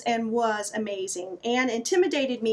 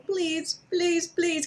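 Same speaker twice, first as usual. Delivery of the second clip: put-on begging child; 5 semitones higher, much slower than usual.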